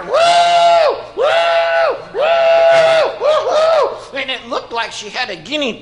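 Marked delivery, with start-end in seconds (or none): 0.0-4.0 loud, very high whooping; harder attention-getting woo. Retold or imitated. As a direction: whoop insistently, mimicking a lady hailing a waiter. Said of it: "So she tried a little harder".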